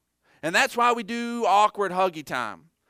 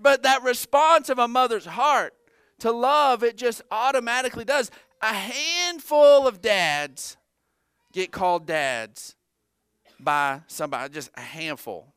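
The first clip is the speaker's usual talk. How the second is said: usual speaking voice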